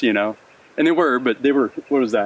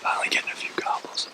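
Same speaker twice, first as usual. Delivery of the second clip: hushed, softer whisper; 11 dB quieter than usual. Real, not acted. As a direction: whisper so the game won't hear. Hunters whispering near turkeys.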